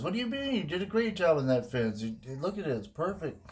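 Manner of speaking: high-pitched incredulous protest; acted disbelief. Buddy defending a great fence job, incredulous. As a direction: protest on your buddy's behalf, voice jumping up. Buddy reacts to unfair refusal.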